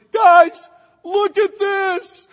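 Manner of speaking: loud, high, drawn-out call to wake everyone; excited wake-up call to the boys in the tent, loud and slow. Retold or imitated. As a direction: call the family over to see it. Waking family to see view.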